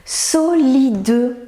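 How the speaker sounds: SOLIDE slowly, syllable by syllable; SO-LI-DE with the final E sounded; reading back syllables. Shown as a demonstration. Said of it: "Léon se relit".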